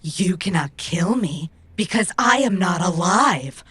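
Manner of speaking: ordinary talk, nothing marked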